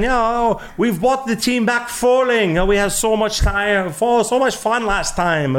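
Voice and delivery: put-on high-pitched, gushing German accent; hammed-up delighted German visitor; Friedrich. German CEO greeting warehouse host, gushing German accent. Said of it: "he's German".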